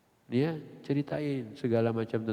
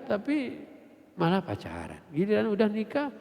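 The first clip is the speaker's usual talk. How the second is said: ordinary talk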